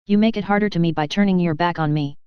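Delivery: plain reading voice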